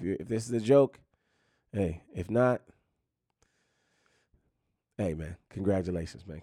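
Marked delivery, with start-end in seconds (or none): none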